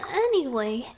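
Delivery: change the subject fast, embarrassed; flustered brush-off. Acted. Merfolk cuts off an awkward explanation, flustered. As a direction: jump up and cut yourself off.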